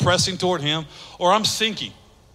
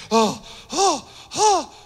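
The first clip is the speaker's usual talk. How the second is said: panicked gasps; 7 semitones higher, much slower than usual. Acted. Acting out panic while sinking.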